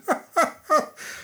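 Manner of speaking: high, fake innocent chuckle; put-on friendly laugh; false laugh. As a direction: laugh to hide the poison. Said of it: "lachte die Alte falsch".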